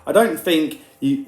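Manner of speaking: normal talking voice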